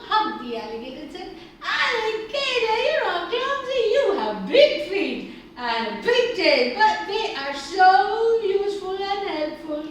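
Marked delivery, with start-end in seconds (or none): 1.6-9.8 loud, high, gushing thanks; grateful praise. A narrator's reading. Friends hug and thank the alligator, gushing. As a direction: proclaim it with big, excited warmth.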